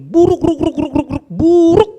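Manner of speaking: buruk chopped into a rising, loud stutter of ruk, ruk, ruk; stuttered emphasis. Shown as a demonstration. Preacher hammering how bad his temper is, loud stutter. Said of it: "buruknya minta ampun".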